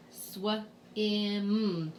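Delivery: "sw-im" split into swuh, then imm; sounding out. Shown as a demonstration. Teacher segmenting a word for spelling, sounded out in parts. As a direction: stretch "sw-im" sound by sound.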